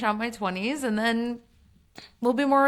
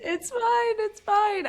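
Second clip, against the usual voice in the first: high squeaky voice; 12 semitones higher, much slower than usual. Acted. Echoes the squeaky joke.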